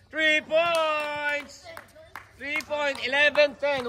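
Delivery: lifted, drawn-out three-point call; basketball play-by-play. Real, not acted. Announcer calls Alan's three-pointer, lively play-by-play. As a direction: sing out the basket, then give the score.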